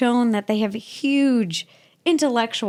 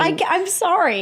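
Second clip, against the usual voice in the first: crying; 5 semitones higher than usual. Real, not acted. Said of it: "You're crying".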